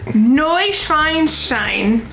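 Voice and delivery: Neuschwanstein dragged out high and slow; stretched German attempt. Shown as a demonstration. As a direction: draw out Neuschwanstein, rising at the end. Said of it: "figure out how to say".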